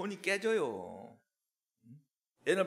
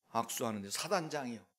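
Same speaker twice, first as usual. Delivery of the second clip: ordinary talk, nothing marked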